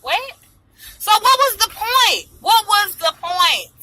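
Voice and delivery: high, slow, stunned; what was the point, in disbelief; outraged disbelief. Real, not acted. Outrage at married man.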